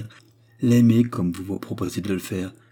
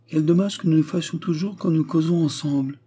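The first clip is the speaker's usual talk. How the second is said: higher, eager regret; bright exclamation; 6 semitones higher than usual. A narrator's reading. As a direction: exclaim it to him, radiant. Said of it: "s'écria-t-elle en levant sur lui ses yeux rayonnants". (exclamation rayonnante)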